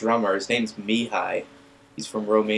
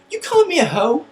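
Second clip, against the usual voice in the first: put-on offended girl; 7 dB louder than usual.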